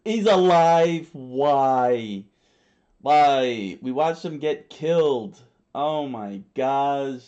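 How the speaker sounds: loud, drawn-out incredulous outburst; real exasperated disbelief. Real, not acted. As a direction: wail in disbelief at the plot twist. Reader reacts to character's return.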